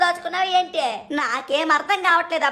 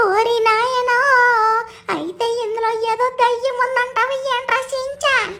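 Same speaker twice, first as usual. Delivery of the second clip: much higher, squeaky cartoon sidekick, worried; high cartoon buddy voice, anxious; cartoon robot cat voice; 8 semitones higher, slower than usual. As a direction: pitch way up for the sidekick, uneasy. Sidekick voice asks about ghost.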